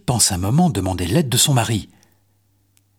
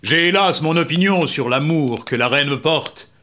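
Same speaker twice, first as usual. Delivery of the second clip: king voice; 5 semitones higher than usual. Acted. King sulks about queen.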